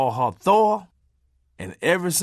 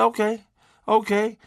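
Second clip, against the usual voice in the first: sarcastic okay; 6 semitones higher than usual. Retold or imitated.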